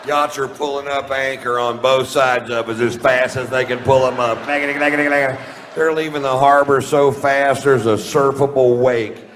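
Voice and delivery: loud, high, urgent reporting; frantic comic commentary. Acted. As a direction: shout the mass panic as it unfolds. Frantic aftermath of the bit.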